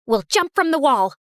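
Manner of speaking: ordinary delivery, nothing marked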